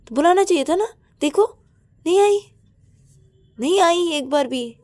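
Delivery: high, loud hurt complaining; sulky rant. Acted. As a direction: vent alone on the bed, offended. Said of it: "ऐसे सोच रही थी".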